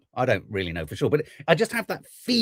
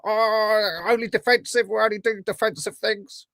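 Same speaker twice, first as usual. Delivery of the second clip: higher, lightly mocking excuse voice; mock politician excuse; 7 semitones higher than usual. Host mocking a leader's defensive excuse, lightly mocking. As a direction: parrot the defensive line with a sneer. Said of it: "He keeps using the word defensive".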